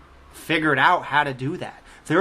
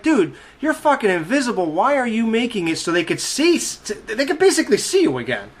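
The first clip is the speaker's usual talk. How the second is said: higher-pitched, incredulous scolding with a laugh; mock exasperation; 7 semitones higher than usual. Telling off the invisible man for smoking, mock exasperated. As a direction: berate him in laughing disbelief.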